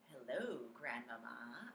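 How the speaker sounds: soft unvoiced whisper. A narrator's reading. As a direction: whisper it close. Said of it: "I whispered".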